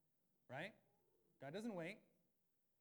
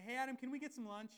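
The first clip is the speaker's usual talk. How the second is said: mock casual; 9 semitones higher, 6 dB louder than usual. Mock casual God invitation.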